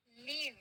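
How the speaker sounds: लीव with a stretched ee; long-vowel leave. Shown as a demonstration. Teacher modelling the bracketed pronunciation, लीव with long ee. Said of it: "इसको कैसे बोलेंगे".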